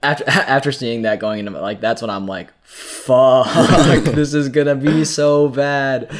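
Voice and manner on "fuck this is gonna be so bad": laughing through a curse, slow; laughing dread. Acted. Character dreading the fight.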